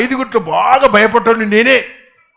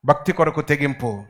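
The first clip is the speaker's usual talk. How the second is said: భక్తి కొరకు తెగింపు softer, lower; 5 semitones lower, 6 dB quieter than usual. Real, not acted. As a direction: drop to a hush. Repeat soft. (మెల్లగా)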